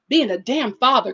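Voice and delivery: normal talking voice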